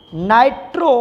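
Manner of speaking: नाइट्रो in two careful parts, rising at the end; pronunciation demo. Shown as a demonstration. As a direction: leave नाइट्रो hanging for the next part. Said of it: "इसकी pronunciation करेंगे". (नाइट्रो का साफ़ उच्चारण, अंत में चढ़ता सुर)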